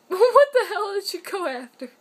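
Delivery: loud, laughing disbelief. Real, not acted. Owner watching her cat chase things, loud laughing question.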